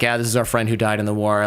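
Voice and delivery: ordinary delivery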